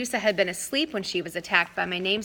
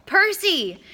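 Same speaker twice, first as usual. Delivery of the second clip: high loud cry of his name; call out; 12 semitones higher, 7 dB louder than usual. Acted. Girl crying out to Percy. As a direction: shout to snap him out. Calling out.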